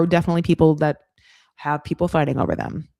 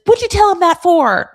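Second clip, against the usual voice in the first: much higher, loud accusing squawk; mock outraged shriek; 12 semitones higher, 10 dB louder than usual. [name] confronting Michael for telling Beer Can, loud and high. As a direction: fire it at him like a betrayed friend.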